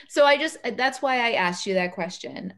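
ordinary delivery

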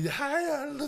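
put-on high whining girl; whiny girl voice. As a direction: whine it like a girl. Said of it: "she's like".

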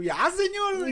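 high-pitched pleading; exasperated little high plea; mock plea. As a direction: beg heaven in a squeaky mock voice. Acting her plea to God.

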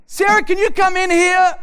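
high, loud call to his wife; loud call for Sarah. Retold or imitated. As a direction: summon her from the other room. Calling wife into the room.

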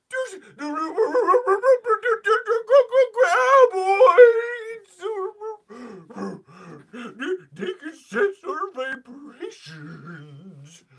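high, loud crying character voice; hammed-up crying over the worms; mock dramatic wail. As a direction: wail it like a terrified movie extra.

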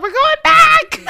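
excited laughing declaration. Retold or imitated. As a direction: shout it through laughter. Decides to return, laughing.

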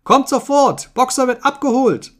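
high, loud alarm call; shrill cry. A narrator's reading. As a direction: shriek the warning with great urgency. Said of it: "mit schriller Stimme schrie".

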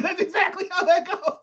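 high, laughing voice; words squeezed through real laughter; laughing agreement. Real, not acted. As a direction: crack up while agreeing. Cracking up while agreeing.

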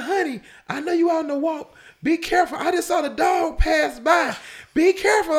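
high, loud wifely warning; imitated worried wife. Wife phones her walking husband about the loose dog, hammed-up high alarm. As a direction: mimic a wife calling out in alarm. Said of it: "my wife called me".

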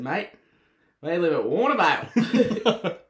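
loud, high, slow mock Aussie punchline. Local imitated revealing home is Warnervale, mock Aussie voice. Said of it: "that's his Aussie voice".

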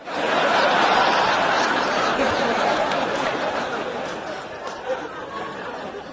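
high, airy, unvoiced laugh; the host cracking up at his own punchline; breathy laugh. Real, not acted. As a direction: let the laugh run out of breath.

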